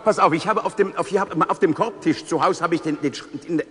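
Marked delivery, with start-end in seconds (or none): none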